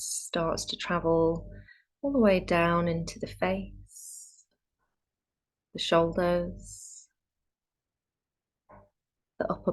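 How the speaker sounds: calm meditation guide with long pauses; guided meditation. Real, not acted. Yoga teacher easing students into Yoga Nidra, calm guided meditation. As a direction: settle them gently into stillness, leaving space between phrases.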